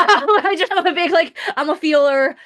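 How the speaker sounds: high, loud laughing burst. Real, not acted. Therapist laughing as she admits loving feelings. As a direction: laugh out the words.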